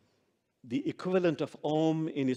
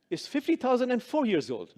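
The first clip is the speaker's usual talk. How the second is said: raised, loud polite correction; high, louder correction; 8 semitones higher, 3 dB louder than usual. Acted. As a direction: insist sweetly, voice raised high. Mullah interrupts again.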